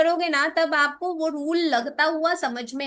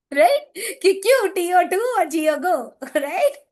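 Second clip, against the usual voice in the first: laughing; 3 semitones higher, 3 dB louder than usual. Real, not acted. Joking about Dharmendra in heaven.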